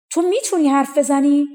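can't believe a cow just spoke; amazed. A narrator's reading. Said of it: "با تعجب به گاو نگاه کرد و گفت".

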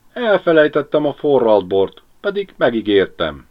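tearful confession, starting high and loud, sinking. A narrator's reading. Ribiszke confesses he forgot the promised wine, tearful. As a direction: own up miserably, near tears. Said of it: "mondta el könnyesedett szemmel Ribiszke".

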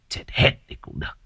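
low, cold whisper; villain whispering his wish. Acted. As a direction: hiss it under the breath. Villain gloats over deaths.

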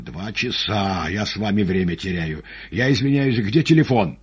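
building to a high, loud demand; agitated demand. Acted. Patient jumps up, demands the telephone.

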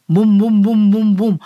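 heavy, loud rhythmic booms; booming thuds. A narrator's reading. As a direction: pound out each boom like a hammer. Narrator imitates giant thuds.

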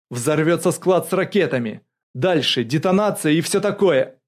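loud, high, urgent warning; blurted out. A narrator's reading. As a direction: blurt out the danger. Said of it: "Богданов выпалил". (выпалить предупреждение)

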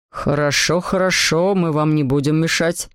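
low-pitched fatherly voice; placating father. A narrator's reading. Father pats Adele and promises to leave, low and placating. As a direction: soothe the child in a deep dad voice. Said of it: "сказал месье Патмой, погладив Адель по голове".

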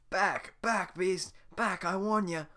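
high loud frightened shout; terrified villager. Acted. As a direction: fend off the beast in panic. Villager waving torch at ogre.

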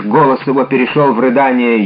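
usual speaking voice

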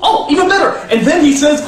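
put-on high, chuckling enthusiasm for a child's idea; delighted parent. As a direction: laugh along, thrilled with your kid. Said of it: "let your five-year-old write the screenplay".

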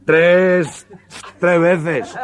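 high, loud stuck start on tres; comic stutterer with a laugh; stutterer's voice. Retold or imitated. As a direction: force out tres through a stammer, laughing. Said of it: "el tartamudo".

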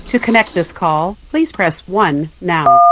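ordinary delivery

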